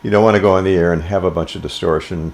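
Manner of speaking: usual speaking voice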